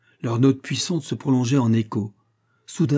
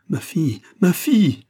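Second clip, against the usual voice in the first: high cry building to a shout; anguished cry; 8 semitones higher, 4 dB louder than usual. A narrator's reading. Father to his endangered daughter, anguished rising cry. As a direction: cry out, mad with grief. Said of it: "hurla William Bolton, fou de douleur".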